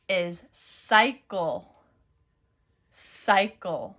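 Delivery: slow, drawn-out dictation read. Shown as a demonstration. Teacher dictating slowly to students. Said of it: "Let's check it together".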